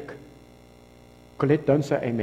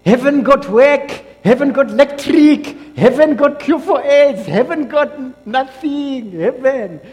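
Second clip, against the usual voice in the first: high, slow, loud put-on accent drawled out; mock local accent; 9 semitones higher, 8 dB louder, slower than usual. Pastor imitating someone praising South Africa, slow loud put-on accent. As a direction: mimic a proud local bragging. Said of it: "Somebody said".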